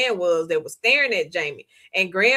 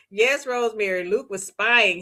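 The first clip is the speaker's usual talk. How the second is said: ordinary talk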